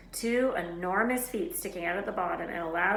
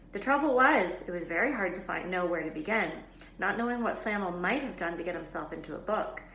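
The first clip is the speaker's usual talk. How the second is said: ordinary delivery, nothing marked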